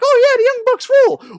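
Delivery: very high, loud put-on young fan voice; mock millennial. Host mocking a millennial wrestling fan, high squeaky voice. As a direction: ham up a squeaky excited kid. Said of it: "one of these millennials".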